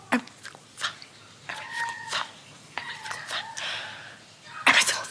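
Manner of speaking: hushed, breath-only repetition; barely audible emphatic whispering; playful whisper. Real, not acted. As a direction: whisper it over and over, insistently. Playfully whispering the repeated vow.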